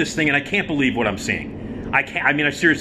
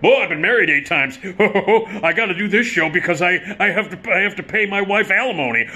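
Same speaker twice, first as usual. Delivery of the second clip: a touch higher, mugging comic voice; hammy stage gag; 5 semitones higher than usual. Retold or imitated. Ad-libbing old comic cracking jokes to the audience. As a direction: sell the joke to the house. Rooney's ad-libbed stage shtick.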